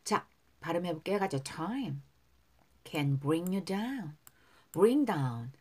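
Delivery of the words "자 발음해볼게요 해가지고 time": ordinary talk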